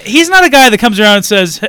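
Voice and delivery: ordinary talk, nothing marked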